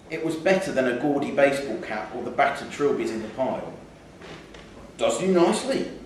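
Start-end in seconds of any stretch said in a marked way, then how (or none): 5.0-5.9 higher, chummy shopkeeper flattery; old shopkeeper. Acted. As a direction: sell the fit to the customer. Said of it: "he'd been told by the".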